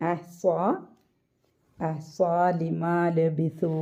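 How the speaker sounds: slow, measured tajweed recitation; Quran recitation. Shown as a demonstration. Teacher modelling the verse for students, slow careful recitation. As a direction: draw out each long vowel, word by word.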